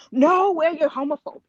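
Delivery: quick indignant imitation of an accuser; mock accusing. Acts out community's knee-jerk accusation.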